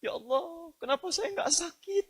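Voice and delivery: high, soft, drawn-out mock weeping plea; imitated tearful lament. Scholar pleading to Allah over not falling sick, imitated tearful lament. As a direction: plead softly and slowly as if weeping. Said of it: "Nangis dia".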